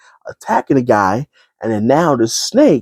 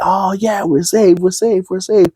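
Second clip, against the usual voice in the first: much higher, excited cheering; mock relief; 10 semitones higher than usual. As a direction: celebrate rescue giddily. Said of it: "They're like".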